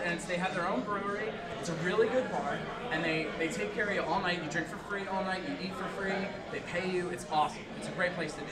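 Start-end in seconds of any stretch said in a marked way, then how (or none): none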